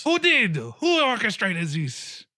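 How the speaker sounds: high, loud frantic scolding; stomping mayor berating Cappy; furious mayor. Acted. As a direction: rant at him like a ruined politician. Mayor demands name.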